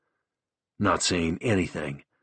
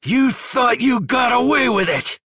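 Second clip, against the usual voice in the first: high, loud furious scream; screamed accusation; 7 dB louder than usual. A narrator's reading. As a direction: hurl it at him in rage. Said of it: "Matt screamed".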